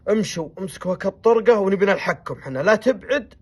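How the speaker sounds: loud, high ordering voice; commanding order. Retold or imitated. Sheikh ordering his people to move on, loud and commanding. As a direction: bark the orders to the departing camp.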